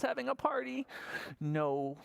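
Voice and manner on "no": ordinary delivery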